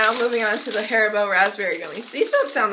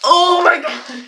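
slow, loud laughing oh my god; laughing shout; 6 semitones higher, 6 dB louder, slower than usual. Real, not acted.

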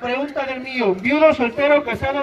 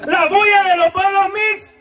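higher, louder rallying shout; 8 semitones higher, 4 dB louder than usual. Shown as a demonstration. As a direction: shout it to rouse the crowd. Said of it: "No, no me convence".

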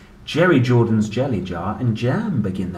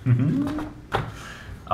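giggle; 12 semitones higher than usual. Real, not acted. Laugh at quackaroo.

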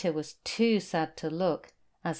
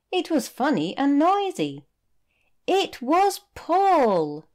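excited reveal; 9 semitones higher, 5 dB louder than usual. A narrator's reading. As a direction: build up to the big surprise. Story climax, friend found.

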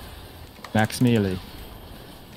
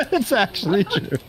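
real high giggle through the words; words broken up by high laughter; laughing while talking; 10 semitones higher than usual. Real, not acted. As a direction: barely get it out for laughing. Cracking up at the TikTok remark.

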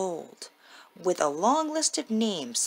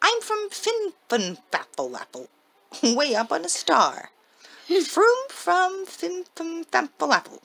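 high pixie voice; 9 semitones higher, 4 dB louder than usual. Acted. Pixie names his home star.

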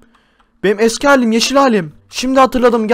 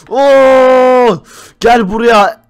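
loud game-fight yell, dragging out the last words; mock angry shout; 3 semitones higher, 6 dB louder, much slower than usual. Player yells angrily at the fireball thrower. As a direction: shout at the attacker to come here.

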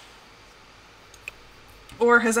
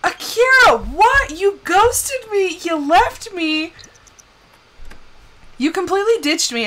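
hammed-up indignant wail; mock outrage; 6 semitones higher, 5 dB louder than usual. Acted quarrel with game character.

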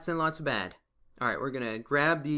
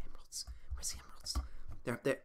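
hushed, breathy whisper; 4 semitones lower, 14 dB quieter, faster than usual. Real, not acted. Player searching the cave for emeralds, whispered. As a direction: whisper it like a secret treasure hunt.

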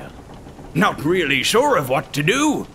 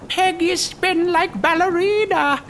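put-on much higher, laughing little-girl voice; giggling toddler. Little girl laughing at the captain's peg leg, giggly child voice.

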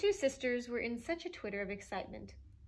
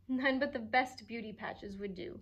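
ordinary delivery, nothing marked

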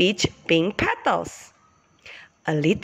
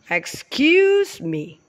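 deep voice; 5 semitones higher, slower than usual. Acted. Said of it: "cried a deep voice".

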